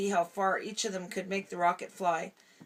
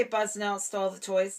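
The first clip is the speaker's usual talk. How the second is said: ordinary delivery, nothing marked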